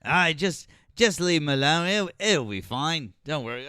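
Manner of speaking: high, loud put-on British voice; mock British reassurance; accidental British accent. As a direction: aim for Aussie, come out British. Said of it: "I'm doing British too".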